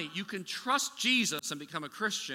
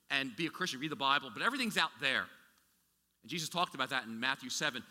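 usual speaking voice

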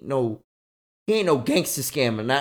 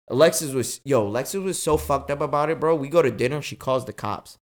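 ordinary talk, nothing marked

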